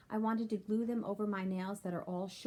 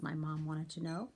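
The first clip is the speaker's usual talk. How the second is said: ordinary delivery, nothing marked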